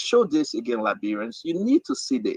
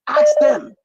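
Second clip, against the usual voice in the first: sudden, much higher, louder shout; shouted demand; 8 dB louder than usual. Real, not acted. Host demanding Liberians ask the children, shouting. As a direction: hurl it at the audience.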